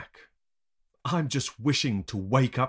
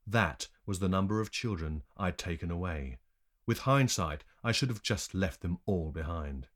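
ordinary delivery

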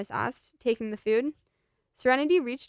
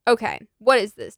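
"what" pushed out louder, insisting; louder insistent repeat; 7 dB louder than usual. Acted. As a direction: ask again, harder this time. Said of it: "Dennis repeated".

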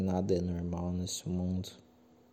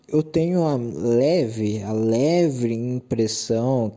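slow, loud buildup; 7 dB louder, slower than usual. Real, not acted. Building suspense.

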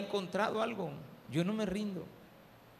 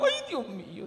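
dismayed exclamation; 5 semitones higher, 4 dB louder than usual. Retold or imitated. Realizes he forgot his wife.

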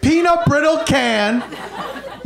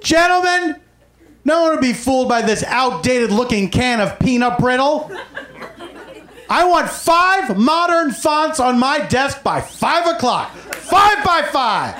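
barking tyrant boss; 4 semitones higher than usual. Retold or imitated. New boss berating staff.

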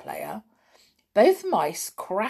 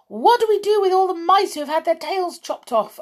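high, fast, loud witch question; eager question; 11 semitones higher, 6 dB louder, faster than usual. A narrator's reading. Witches to their leader, fast eager question. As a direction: blurt it out. Asked the audience.

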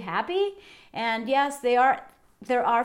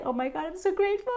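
gushing gratitude; 7 semitones higher, much faster than usual. Retold or imitated. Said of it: "Yesterday was like".

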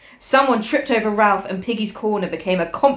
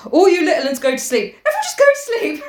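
quavering voice; 8 semitones higher, 3 dB louder, faster than usual. A narrator's reading. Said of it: "in a quavering voice".

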